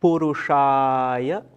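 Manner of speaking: slow, drawn-out Sanskrit recitation; word-by-word mantra teaching. Shown as a demonstration. Teacher giving mantra words for students to repeat, slow recitation. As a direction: intone each word slowly, holding the long vowels. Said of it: "one word at a time".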